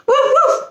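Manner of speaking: high, excited puppy woofs; puppy bark. A narrator's reading. Puppy riding a fire truck, high barking. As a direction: yip it like a little dog. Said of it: "Lucky barked".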